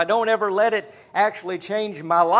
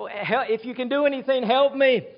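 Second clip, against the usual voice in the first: higher, pleading cry for help; desperate father pleading; 6 semitones higher than usual. Acted. Father begs Jesus to heal his son, pleading. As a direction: beg as a father out of options. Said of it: "And the man is desperate".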